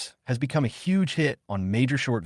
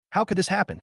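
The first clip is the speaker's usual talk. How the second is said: a mild tearful cry, pitched up; 10 semitones higher, faster than usual. A narrator's reading. Shocked girl reading the news, mildly tearful. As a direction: let a little tearfulness into the question. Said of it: "voice was filled with tears".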